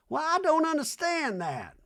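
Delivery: high, slow hammed-up Reba; lofty slow mock-mom rant. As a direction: drone it like a grand old dame. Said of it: "Reba in scene".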